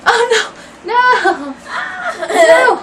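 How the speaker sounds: high, loud laughing protest. Real, not acted. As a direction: laugh through each no. Laughing at mess everywhere.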